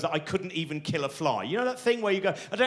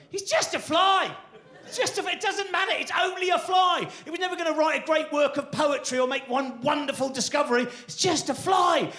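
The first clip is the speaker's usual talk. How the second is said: dismissive inner voice; 11 semitones higher than usual. Retold or imitated. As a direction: brush it off in a high shout. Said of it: "my own mind going".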